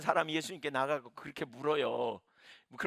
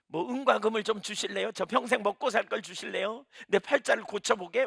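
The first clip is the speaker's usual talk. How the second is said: cheeky greedy request; 6 semitones higher, 5 dB louder than usual. Retold or imitated. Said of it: "이렇게 말할 수도 있잖아요".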